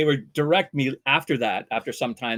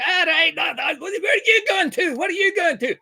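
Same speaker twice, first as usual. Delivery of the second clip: high, loud mock-Scottish accent; rowdy fake Scot hollering; 5 dB louder than usual. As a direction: shout it in an exaggerated Scottish burr. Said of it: "we're continuing doing our Scottish".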